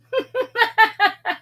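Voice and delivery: high cheeky laugh. A narrator's reading. Sam laughing at the furious villagers. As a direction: laugh loudly at everyone. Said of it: "But Sam just laughed".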